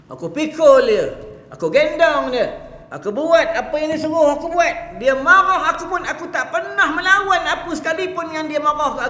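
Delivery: high, loud fervent preaching, building; preaching at full force. Acted. Preacher voicing the devoted youth to the congregation, in full-force fervent preaching. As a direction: cry it out to the crowd like a revival preacher. Youth tells of serving his mother.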